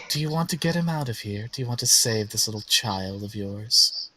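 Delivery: measured, lightly voiced villain; a touch of condescending praise; tempting villain. Villain offering to free the player's child, measured tempting tone.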